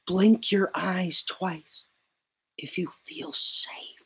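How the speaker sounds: slow, whispered mock concern. Drunk journalist whispering to the bartender, slow mock concern. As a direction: hush it like a patronizing rescuer. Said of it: "And then he says".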